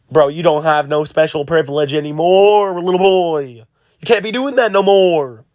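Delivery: lightly voiced, rising scolding of a little boy; a hint of indignant older brothers telling him off; mock scolding. Sanji and Brook scold Momonosuke.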